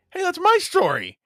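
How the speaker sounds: over-the-top indignant protest; mock indignant shout. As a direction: yell it like a jilted partner. Mock quarrel in analogy.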